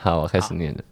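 normal talking voice

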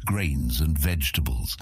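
plain reading voice

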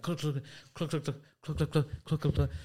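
mock click sounds; clicking batteries. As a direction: imitate rattling batteries. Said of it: "I'm like".

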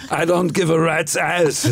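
put-on slow Austrian accent on I don't give a rat's ass; deliberate Austrian muscleman delivery; Arnold Schwarzenegger. Asked by the hosts, a slow Austrian accent. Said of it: "Arnold Schwarzenegger".

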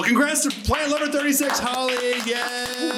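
high, bright congratulations; excited announcement. Real, not acted. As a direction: announce the winner with joy. Announcing the award winner.

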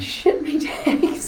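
breathy laughing repeat of the slang; laughing mock phrase. Host repeats the guest's slang through a laugh. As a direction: giggle it out slowly, half-voiced.

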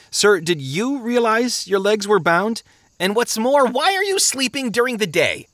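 high, urgent, incredulous questioning. Acted. Traveler challenging chained men sleeping by day, urgent. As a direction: press them with rising alarm.

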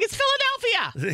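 high-pitched, incredulous outburst; emphatic exclamation. Real, not acted. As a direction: exclaim it high, as the obvious reason. Exclaims why she stayed silent.

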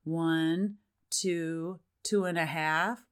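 slow, lilting count with a rise on "two and a half"; sing-song lilt. Shown as a demonstration. Parent counting to a child, lilting sing-song. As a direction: let "two and a half" float up slowly, teasing. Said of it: "Can you hear that lilt in my voice?".

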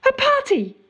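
high, delighted cry of discovery; surprised exclamation. Retold or imitated. Bursting in on the lab party.

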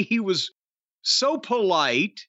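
ordinary talk

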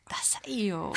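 very soft, voiceless whisper; whispered put-down. Real, not acted. Co-host echoes her partner's verdict on the listener, whispered put-down.

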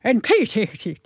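muddled attempt at soft Irish; hard-to-follow mock-Irish lilt. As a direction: put on a gentler Irish brogue, words garbled. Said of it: "A softer Irish".

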